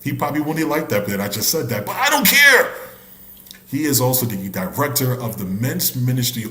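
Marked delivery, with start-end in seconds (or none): 1.9-2.7 high, loud playful shout; cheeky yell, pitched way up; mock defiant outburst. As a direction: leap up into a comic holler. Mock defiance about teasing the pastor.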